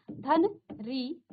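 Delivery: धन री drawn out slowly; slow demo. Shown as a demonstration. Teacher showing how the vowel comes out. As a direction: stretch धन री to show the vowel. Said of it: "स्वर इस प्रकार से निकलेगा".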